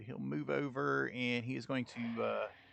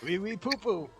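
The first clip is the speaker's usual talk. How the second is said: mock French accent; 8 semitones higher, 4 dB louder than usual. Mock French banter, laughing.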